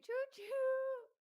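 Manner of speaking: high, loud "choo choo"; playful train toot, long and high; train whistle call. Pretend. Train whistle cheer welcoming member.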